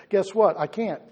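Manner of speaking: usual speaking voice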